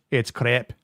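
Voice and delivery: "crepe" as crap with a Scottish vowel; Scottish-style "crepe". Acted. Host riffs on a Scottish catchphrase, "crepe" as accented crap. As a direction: put a little accent on "crepe". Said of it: "I hope I pronounce it crepe right".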